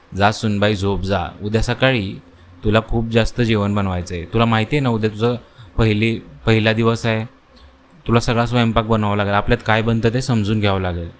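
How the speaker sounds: plain reading voice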